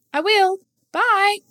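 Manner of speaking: put-on very high, eager child voice; polite little boy. A narrator's reading. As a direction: ask sweetly to be let in. Boy waving goodbye.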